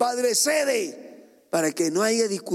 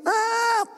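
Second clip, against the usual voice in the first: a sudden piercing tantrum wail; child's scream; 13 semitones higher than usual. Retold or imitated. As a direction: scream like a furious toddler. Child screaming over breakfast.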